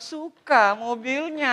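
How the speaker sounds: slow, drawn-out mock longing; imitating his wife. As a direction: stretch the words like a coaxing wife. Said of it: "istri saya bilang".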